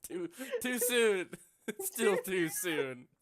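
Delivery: laughing through a mock-pained too soon. Real, not acted. Laughing to her friend about a sad anime episode.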